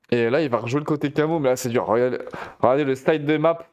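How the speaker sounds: usual speaking voice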